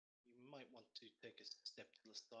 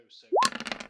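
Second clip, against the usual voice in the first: shrieking despair, far higher and louder; anguished squeal; 29 dB louder than usual. Real, not acted. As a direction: wail it as the damage comes in. Player reacts to damage roll.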